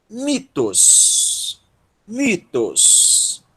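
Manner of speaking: "Mitos" drawn out, first syllable stressed. Shown as a demonstration. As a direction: lean on the MI of "Mitos", then let it fall. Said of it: "É a sílaba tônica".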